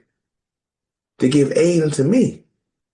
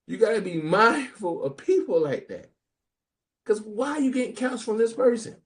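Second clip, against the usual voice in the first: usual speaking voice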